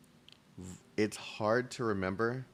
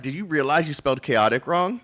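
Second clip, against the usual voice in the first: mock Comey; 5 semitones higher, 7 dB louder than usual. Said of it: "Comey's gonna be like".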